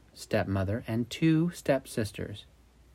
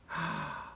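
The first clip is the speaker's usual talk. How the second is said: soft gasp; 4 semitones higher, 11 dB quieter than usual. Acted. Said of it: "gasped the stepsisters".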